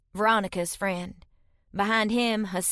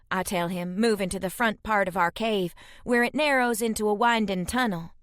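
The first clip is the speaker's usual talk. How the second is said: plain reading voice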